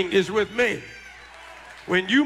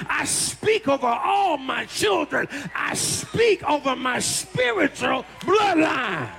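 high, driving preacher's declaration; full-force preaching; 5 semitones higher than usual. Acted. Preacher to congregation, full-force preaching. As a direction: proclaim it over them.

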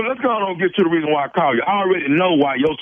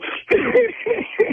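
shrieking high laugh at the reveal; high cackling laugh. Real, not acted. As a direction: cackle high at your own prank.

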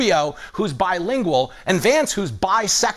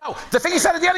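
ordinary talk, nothing marked

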